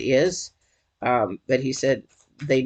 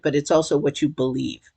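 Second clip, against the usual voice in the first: normal talking voice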